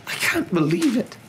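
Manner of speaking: gasping grief. Acted. Professor hears her friends' deaths confirmed, gasping grief. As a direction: let it out high and disbelieving. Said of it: "Professor McGonagall gasped".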